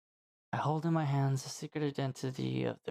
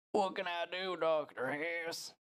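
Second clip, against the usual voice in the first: higher, drawn-out patient voice; pleading patient; 5 semitones higher, slower than usual. Acted. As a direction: plead with the doctor, stretching the words. Acted patient pleading with doctor.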